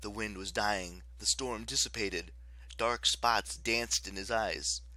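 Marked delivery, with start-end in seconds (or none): none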